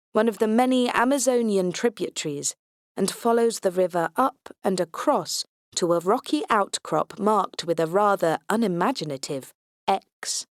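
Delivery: ordinary delivery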